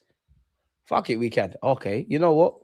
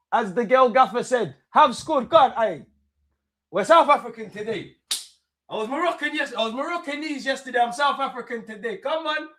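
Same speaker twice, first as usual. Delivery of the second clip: high-pitched excited crowing; gleeful celebration; 11 semitones higher than usual. Real, not acted. Moroccan fan claiming to be South African today, high and excited. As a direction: celebrate your newly adopted team, pitched way up.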